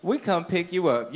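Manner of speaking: ordinary talk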